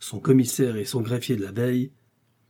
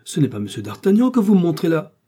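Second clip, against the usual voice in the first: higher, louder protest; flustered correction of an official; astonished exclamation; 8 semitones higher, 8 dB louder than usual. A narrator's reading. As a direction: exclaim it, jumping up in pitch mid-sentence. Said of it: "s'écria Bonacieux".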